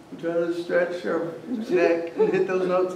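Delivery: stretched-neck strained singing voice; straining neck demo. Shown as a demonstration. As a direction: strain upward like reaching for notes. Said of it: "Another thing we do is we like this".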